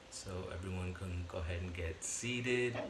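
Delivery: unhurried phrases, spaced out for breathing; guided meditation. Real, not acted. Teacher leading listeners through breathing in and out, guided meditation voice.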